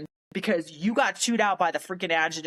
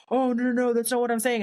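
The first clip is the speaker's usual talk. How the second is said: imitated backpedalling; 6 semitones higher than usual. Colonel backpedals.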